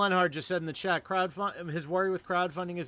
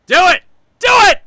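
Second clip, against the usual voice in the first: screaming high and loud; hype shout; 21 dB louder than usual. Real, not acted. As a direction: yell it like a hype man.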